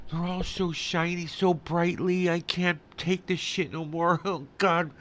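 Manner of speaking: fed-up ranting; pissed off. Real, not acted. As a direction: whine in exasperation at the end of your patience. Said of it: "I'm so pissed off".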